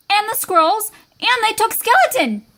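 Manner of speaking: high, emphatic end of a list; mounting dismay. A narrator's reading. Storyteller listing what raiders took, high and dismayed. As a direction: build to the worst loss. Narrator builds to the worst loss.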